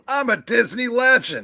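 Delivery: high, loud mock boast; hammed-up high-pitched exclamation; Jack Kirby. Acting out Jack Kirby exclaiming proudly.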